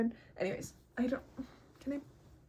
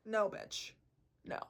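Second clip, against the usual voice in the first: ordinary delivery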